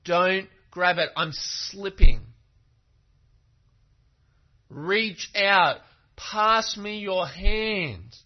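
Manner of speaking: loud, drawn-out shout of warning; urgent calling out. Acted. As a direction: shout it across to someone slipping away. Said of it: "he then calls out to her".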